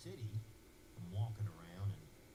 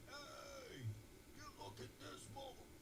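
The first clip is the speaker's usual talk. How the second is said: rowdy firefighters; 12 dB quieter, slower than usual. Retold or imitated. Firefighters cheering.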